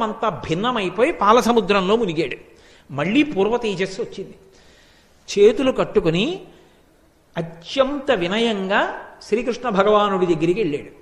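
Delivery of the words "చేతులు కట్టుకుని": ordinary talk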